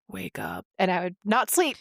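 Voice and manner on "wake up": low, soft eerie bunny whisper-voice; a spooky imitated bunny calling you awake; creepy bunny voice. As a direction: murmur it like a nightmare rabbit in the dark. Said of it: "I would hear that bunny going".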